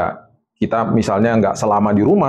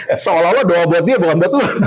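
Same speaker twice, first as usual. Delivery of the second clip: high-pitched talk breaking into laughter; amused laughing; 8 semitones higher than usual. Real, not acted. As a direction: say it through real laughter. Joking about the frightened children's prayers.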